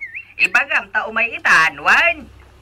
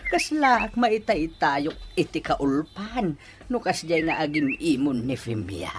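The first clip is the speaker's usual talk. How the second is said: ordinary delivery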